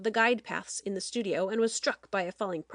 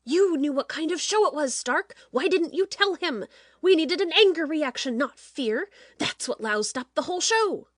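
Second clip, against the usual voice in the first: loud, raised tirade; angry berating; 6 semitones higher, 4 dB louder than usual. Acted. Producer berates Stark over the lost show, loud and angry. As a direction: tear into him for his mistake.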